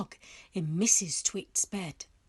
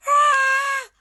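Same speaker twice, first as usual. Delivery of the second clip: piercing shriek of fright, much higher and louder; startled hag screaming in bed; 11 dB louder than usual. A narrator's reading. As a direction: scream as something slimy touches her feet. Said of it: "She screamed".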